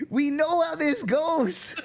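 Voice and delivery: slow, high, laughing heckle; high mock yelling. Joining the crowd against Skynet, high mock yelling. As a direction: drag out a high mock protest.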